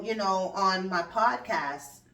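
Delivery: ordinary talk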